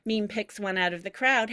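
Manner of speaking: usual speaking voice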